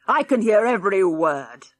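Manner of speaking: a mild mock shout from grandad; old man shouting. A narrator's reading. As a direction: snap across the table at them. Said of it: "shouted Grandad".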